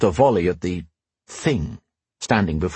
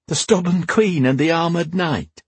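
higher-pitched, lightly amused queen; 8 semitones higher than usual. A narrator's reading. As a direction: echo it in a high, teasing voice. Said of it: "Anise laughed".

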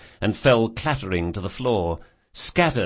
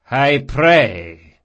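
bantering; 5 semitones higher, 8 dB louder than usual. A narrator's reading. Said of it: "with a bantering tone".

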